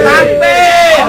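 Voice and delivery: full, high shout; rally the crowd with one cry; shouted takbir. Real, not acted. Preacher calls takbir to crowd.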